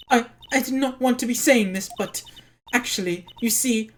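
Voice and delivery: lightly voiced, halting woman witness; timid witness owning up to the court; hesitant witness.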